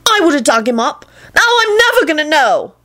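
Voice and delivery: put-on high, aggrieved boyish protest; angry shouting boy. A narrator's reading. Boy blaming the others for his loss, high angry protest. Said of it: "he finally shouted".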